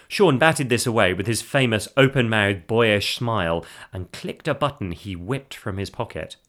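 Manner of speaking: ordinary delivery, nothing marked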